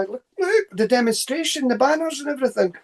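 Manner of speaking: high, hurried imitation of a worried warner; acted high-pitched alarm; urgent retold warning. Acted warning about the demonstrators outside.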